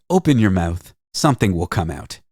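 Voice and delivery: usual speaking voice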